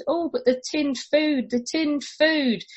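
high, drawn-out mock lament; mocking whine. Mocking plea about tinned food.

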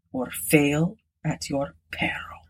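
whispered, ominous warning. Acted. The old man whispering a final warning to Reginald, ominously. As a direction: breathe the threat out.

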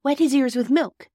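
put-on high, loud child's instruction; urgent Bobby. A narrator's reading. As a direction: call out the idea urgently. Narrator voices Bobby giving orders.

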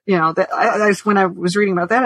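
ordinary talk, nothing marked

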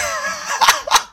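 sehr hohes, lautes Auflachen; shrieking laugh. Real, not acted. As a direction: lacht schrill los über die eigene Geschichte. Laughs after his own story. (hohes Gelächter)

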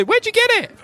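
high, loud urchin voice; street urchin. Acted. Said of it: "They say".

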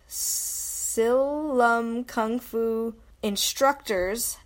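very slow, each part drawn out; slow dictation. Real, not acted. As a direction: read the address out slowly for listeners to write down. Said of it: "So that's S I L".

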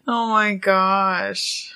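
breathless laugh-crying; gasping through tears of laughter; real laugh-cry. Real, not acted. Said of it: "you made me laugh cry".

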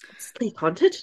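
put-on another go at an Irish accent, rising at the end; mock Irish question, rising on "haunted"; attempted Irish accent. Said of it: "quite a hard sentence to say in an Irish accent".

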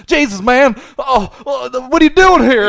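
high, flustered Southern yelp; Southern twang. Retold or imitated. Caught roommate to the man at the door, flustered Southern twang. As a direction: stammer it out in a panicked Southern accent. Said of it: "He had the Southern twang".

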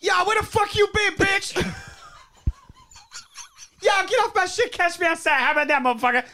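high-pitched, hammed-up comic tirade; Bernie Mac. Playing a mum reunited with her daughter, ranting comedian impression. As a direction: shout it like an outraged stand-up act. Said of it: "Bernie, can you do an impression of this".

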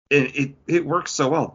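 usual speaking voice

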